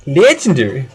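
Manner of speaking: loud, swooping hammed-up delivery; stupid voice. Commentator repeating his word after being laughed at, in a silly voice. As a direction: ham up the word with a big pitch swoop. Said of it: "stupid voice".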